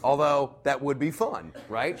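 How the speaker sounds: ordinary delivery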